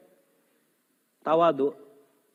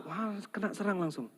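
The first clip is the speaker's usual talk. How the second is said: mock muttered aside; 6 semitones higher, 8 dB quieter than usual. Husband's aside.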